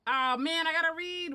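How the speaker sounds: dismayed whine. Real, not acted. Whining about unvoiced dialogue.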